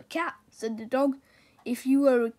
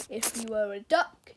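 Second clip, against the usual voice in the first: ordinary delivery, nothing marked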